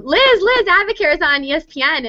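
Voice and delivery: grandmother calling out, high-pitched; imitated yelling. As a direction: call out excitedly like a grandmother from another room. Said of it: "she's yelling".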